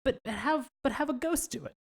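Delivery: high-pitched comic insistence; squeaky, amused correction; joking aside. Real, not acted. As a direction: pitch it up high for the silly add-on. Joking amendment, pitch jumps up.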